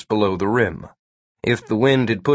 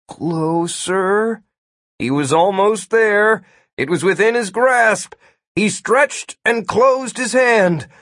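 narration climbing high with urgency; straining toward the climax; mounting suspense; 10 semitones higher, slower than usual. A narrator's reading. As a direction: build the tension, pitch rising. Narration mounts as he nears staff.

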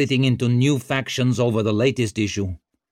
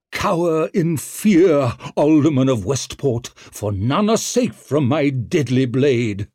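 hammy villain snarl; 5 semitones higher, 5 dB louder than usual. Acted. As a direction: ham it up like a stage villain. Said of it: "and snarled the words".

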